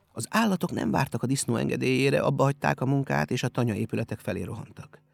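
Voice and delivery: usual speaking voice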